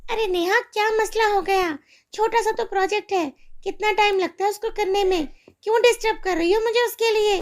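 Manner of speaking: a careless mother brushing off her daughter; irritated mother. Acted.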